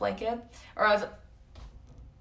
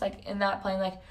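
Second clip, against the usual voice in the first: ordinary talk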